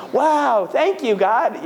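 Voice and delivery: hammed-up thanks to heaven; mock delight. Imagined winner thanking God, mock overjoyed. Imagined joy at cash package.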